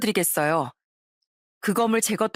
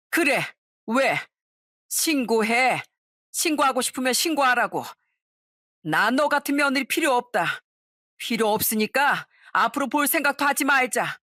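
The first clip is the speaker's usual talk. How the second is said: defiant angry yell; 5 semitones higher than usual. Retold or imitated. Mother-in-law defiant after throwing phone.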